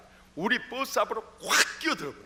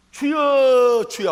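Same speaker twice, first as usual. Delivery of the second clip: high, loud call of "주여"; loud cry to the Lord; 7 semitones higher, 11 dB louder, much slower than usual. Shown as a demonstration. Preacher showing how to die to self. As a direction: cry out as if surrendering. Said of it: "하고 죽어버려야 돼요".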